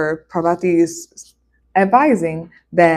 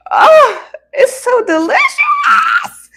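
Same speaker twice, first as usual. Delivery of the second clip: very high, slow, loud squeal of delight; mock delight; 5 dB louder, slower than usual. Savouring the vinegar cookies with a high squeal. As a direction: squeal like it's the best thing ever.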